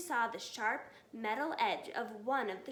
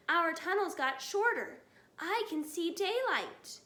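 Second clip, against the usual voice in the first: excited; 5 semitones higher, 4 dB louder than usual. A narrator's reading. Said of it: "said one of the small foxes".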